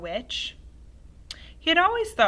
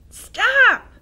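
loud, high yell, terrified; frightened shout; 16 dB louder than usual. Acted. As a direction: scream for her to stop. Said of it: "he cried out".

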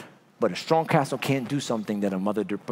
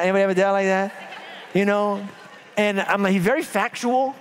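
ordinary delivery

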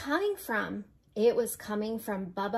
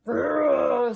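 loud, rolling "grrr"; bear growl; 3 semitones higher, 8 dB louder than usual. A narrator's reading. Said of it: "Old Bear growled".

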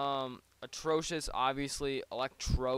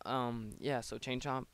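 ordinary talk

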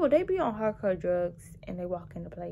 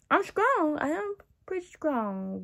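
higher and louder boast; proud boast; 8 semitones higher, 4 dB louder than usual. Real, not acted. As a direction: shout it out proudly. Contrast after whispered lines.

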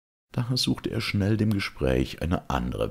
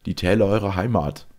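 Georg, higher, louder and quick; young knight's rousing promise; 6 semitones higher, 6 dB louder than usual. Acted. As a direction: take up the words with fervour.

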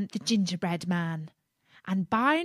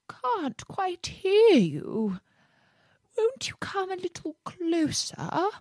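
feeble fox voice; 11 semitones higher than usual. Acted. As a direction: fake weakness to lure him. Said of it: "said the fox in a feeble voice".

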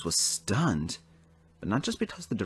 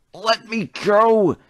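high, loud, slow angry yell; furious cry; 13 semitones higher, 10 dB louder, much slower than usual. A narrator's reading. Thomas yells at Percy and Toby, furious and loud. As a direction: strain against them, raging. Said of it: "Thomas cried".

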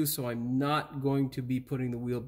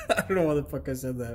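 laughing; 2 semitones lower, faster than usual. Real, not acted. Laughing at own joke.

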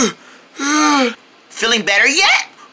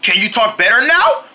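ordinary delivery

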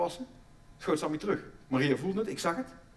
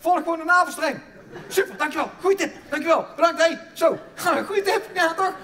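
hammed-up panic; 8 semitones higher, 10 dB louder than usual. Comic delivery-room chaos, shouting, laughing.

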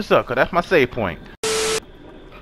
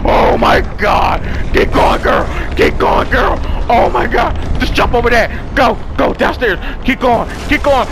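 loud, frantic shouts, voice jumping high; panicked urging; 6 semitones higher, 4 dB louder than usual. Real, not acted. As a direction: urge her on in real panic. Still fleeing, urging the girl on.

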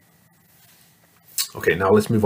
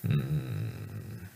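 giant hmm; 9 semitones lower, 11 dB quieter than usual. Acted. Giant pondering in story.

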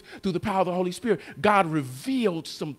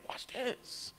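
whispered aside; 5 semitones higher, 18 dB quieter than usual. Real, not acted. Preacher's aside to congregation.

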